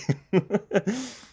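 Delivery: high-pitched delighted laugh. Real, not acted. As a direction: burst into a high squealing laugh. Laughing at game character.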